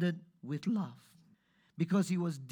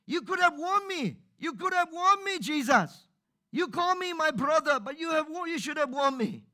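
high, loud, accusing wail; accusing outcry; 10 semitones higher, 5 dB louder than usual. Retold or imitated. Preacher imitating a hurt believer blaming Jesus, high loud wail. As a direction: complain to Jesus, pleading and hurt. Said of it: "People cried out".

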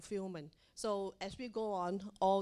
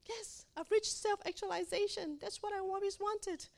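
high, bright cry of triumph; excited self-quote of a dream come true; triumphant joy; 10 semitones higher than usual. Retold or imitated. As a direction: relive the proud moment, voice jumping up. Proud photo at her university.

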